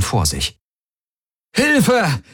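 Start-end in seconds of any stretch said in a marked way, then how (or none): 1.5-2.2 very high-pitched desperate call; high, strained cry for help. A narrator's reading. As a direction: call out for rescue, voice leaping up. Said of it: "rief er".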